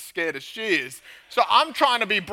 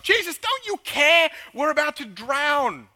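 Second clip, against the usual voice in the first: high, drawn-out mock wail of panic; panicked cry; 8 semitones higher, 4 dB louder, slower than usual. Followers waking Jesus in the storm, panicked cry. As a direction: wail it out in acted desperation. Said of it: "They were panicking".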